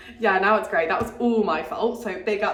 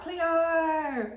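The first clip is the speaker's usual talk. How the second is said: put-on Australian accent; 5 semitones higher, 3 dB quieter than usual. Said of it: "everyone was speaking their Australian accents".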